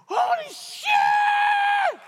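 very high, loud, drawn-out slow yell; retold yell. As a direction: stretch the shout as the power overwhelms him. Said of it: "he starts yelling".

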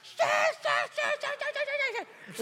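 high, loud repeated yelling; retold yelling. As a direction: fire off each word as a rising yell. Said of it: "he starts yelling".

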